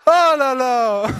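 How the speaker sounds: slow, loud, laughing exclamation; laughing at himself. Real, not acted. Preacher laughing at his own fervor, loud slow laugh. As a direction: laugh it off after getting carried away. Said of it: "Désolé, je m'enflamme".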